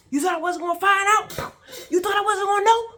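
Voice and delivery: very high, full-force shouted questions; furious yelling. Retold or imitated. Swinging the belt at him, furious yelling. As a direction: yell each accusation at the top of your voice.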